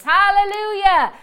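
high, loud shouted praise; exultant, demonstrated praise; rejoicing. Retold or imitated. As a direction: shout hallelujah as if dancing. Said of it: "I'll start dancing and rejoicing".

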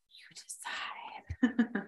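slow spooky whisper. Acted. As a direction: breathe it like a secret. Spooky teaser about Lizzie Borden.

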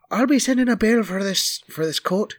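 high-pitched yelling over a bill; indignant yelling. Acted. Marley complaining while being pushed away, yelling. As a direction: yell it high, without much extra volume. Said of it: "Marley is yelling".

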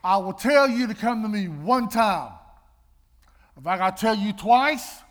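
loud, stern parent laying down the law; booming mother's warning to her kids. Retold or imitated. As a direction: bark it like a drill sergeant. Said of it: "my motto was this".

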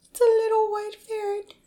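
high, tiny cooing voice; cutesy squeal. Real, not acted. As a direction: coo over something small and cute. Cooing over the tiny ferret.

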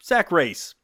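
a hint of a TV reporter live on location. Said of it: "you know how the reporters always".

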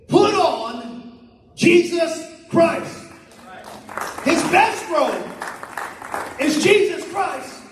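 high, slow preaching emphasis. Acted. Pastor to congregation, slow, high, emphatic preaching. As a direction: draw each word out, lifting the congregation. Pastor lifts voice on key line.

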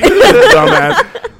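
high, loud laughing jeer; laughing mockery. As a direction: drag out the taunt through laughter. Friends mocking him, retold laughing.

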